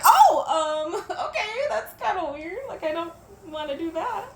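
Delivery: teary laugh, voice up high; laughing through tears. Real, not acted. Talking to viewers about her idol, teary laugh. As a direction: laugh it off while crying. Laughing while crying over it.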